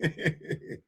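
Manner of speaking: brief quiet laugh; soft quick ripple of laughter; short chuckle. Real, not acted. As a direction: chuckle at your own line. Laughs at own line.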